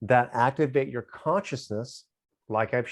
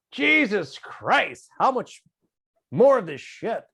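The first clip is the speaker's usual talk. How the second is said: high, slow and louder, fed up with it all; exasperated old father; 10 semitones higher, 6 dB louder, slower than usual. Retold or imitated. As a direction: drag it out like a worn-out old man who's had enough. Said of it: "he just went".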